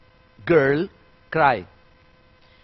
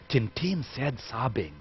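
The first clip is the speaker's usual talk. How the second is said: hushed, slow rumor-monger whisper; gossiping whisper; 4 semitones lower, 12 dB quieter, slower than usual. Shown as a demonstration. Narrator sharing gossip with the audience, softly. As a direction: lean in and spill the news softly. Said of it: "So the narrator says".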